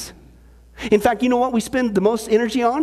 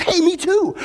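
excited; 8 semitones higher than usual. Retold or imitated. Eager joke character, excited.

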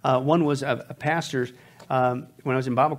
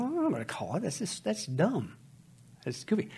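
soft, slow, scoffing to himself; dismissive grumbling; 5 semitones higher, 6 dB quieter than usual. Retold or imitated. Pastor arguing with himself in the car, softly scoffing. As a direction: brush it off as dumb under his breath.

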